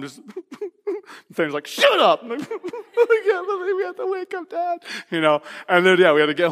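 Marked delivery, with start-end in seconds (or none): 1.7-2.2 crying. Retold or imitated. Scared boys after crash. 2.4-4.8 laugh-crying. Retold or imitated. Dreading telling dad after crash.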